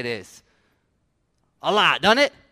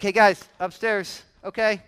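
put-on raised-voice call to the children; parent calling kids; 3 semitones higher, 7 dB louder than usual. Parent sending kids up to bed, calling out.

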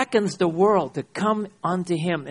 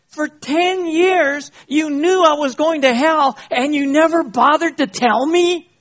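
loud, high, incredulous yell; outraged shout; 11 semitones higher, 9 dB louder than usual. Acted. Father confronts son who never told him, outraged. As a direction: shout it in disbelief, stretching the opening words.

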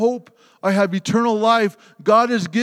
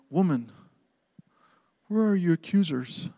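tender; 7 dB quieter than usual. Acted. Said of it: "so much love in His face".

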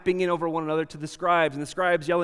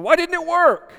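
much higher, louder mock bafflement; baffled whine; 11 dB louder than usual. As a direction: whine it out, puzzled and indignant. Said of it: "They're just going".

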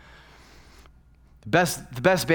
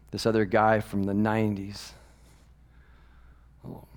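ordinary talk, nothing marked

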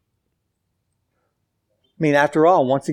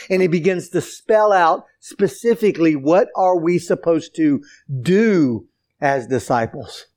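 ordinary delivery

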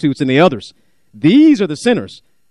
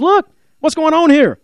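mild angry son; 9 semitones higher than usual. Retold or imitated. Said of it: "He says".

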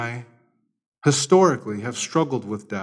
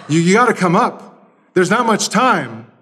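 higher, louder and fast; urgent call; 6 semitones higher, 4 dB louder than usual. Acted. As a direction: hurry him up the ladder. Helicopter crew urges him.

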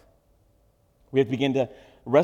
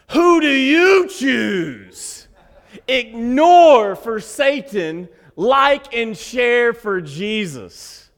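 big, slow, hammed-up announcer reading the meme; mock meme voice; 10 semitones higher, 11 dB louder, much slower than usual. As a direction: boom it out like a cheesy Facebook post, high and loud.

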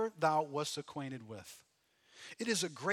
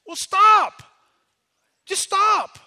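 high, loud command to quit; sharp stop; 13 semitones higher, 16 dB louder, much slower than usual. Acted. Preacher ordering his own mind to stop, loud. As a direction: cut yourself off loudly. Scolds himself in sermon.

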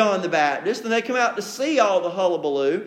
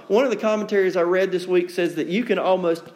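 ordinary talk, nothing marked

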